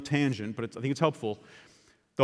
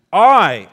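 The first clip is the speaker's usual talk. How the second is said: I punched hard and loud; I said with heavy emphasis; stress on I; 3 semitones higher, 13 dB louder than usual. Shown as a demonstration. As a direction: hit the word I like it's underlined. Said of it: "you should underline the pronouns".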